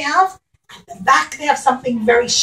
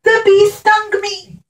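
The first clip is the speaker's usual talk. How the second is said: high, a touch louder accusing; king blaming the bee; 8 semitones higher, 4 dB louder than usual. Acted. The king tells the bee it stung him, mildly upset. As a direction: point at the culprit. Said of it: "he says".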